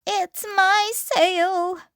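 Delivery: high, loud, slow goat voice; loud high animal voice. Acted. Goat speaks.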